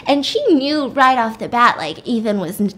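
ordinary delivery

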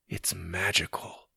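soft, slow awe; hushed wonder. Acted. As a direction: say it slowly, staring up in wonder. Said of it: "staring up in wonder".

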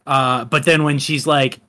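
ordinary talk

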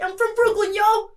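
high, loud Brooklyn caricature; brash mock-American, full volume; shouted American accent. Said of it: "It's like my American".

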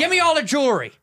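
high, loud order; robber's demand. Acted. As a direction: demand it like a stick-up man. Acting out an armed robbery.

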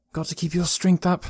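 high-voiced, mild self-scolding; chiding himself. A narrator's reading. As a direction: scold yourself gently in a high voice. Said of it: "he chided himself".

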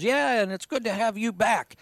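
imitating a glad, high-pitched reply; retold welcome. Host voicing a worker welcoming him back, glad. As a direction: lift the voice well up for a quoted welcome.

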